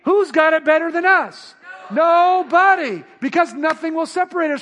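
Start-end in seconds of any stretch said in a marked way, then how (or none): 0.0-1.5 loud, raised stadium shout; full shout. Acted. Preacher redoing the chant properly for the church, shouted. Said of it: "Oh, that is just so lame". 1.9-3.0 slow, loud, raised "Nobody" held long; drawn-out shout. Acted. Preacher answering his own rally cry, slow shout.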